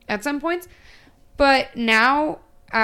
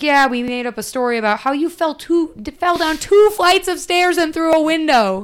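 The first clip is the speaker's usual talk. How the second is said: mock cheery cover story; 7 semitones higher, 6 dB louder than usual. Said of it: "they're like".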